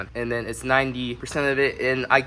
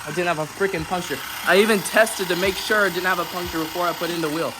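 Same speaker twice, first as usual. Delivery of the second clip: higher-pitched frustrated rant; real anger; 4 semitones higher than usual. Real, not acted. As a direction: vent real frustration over the flat that won't fill. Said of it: "I'm actually extremely pissed off right now".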